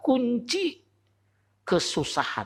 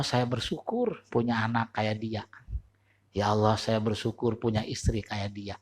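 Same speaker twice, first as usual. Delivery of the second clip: grateful prayer; 6 semitones lower, 5 dB quieter than usual. Shown as a demonstration. Preacher shows the congregation a quiet, humble prayer of thanks. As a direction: murmur thanks to God, softly and humbly. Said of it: "Ganti dengan kalimat syukur".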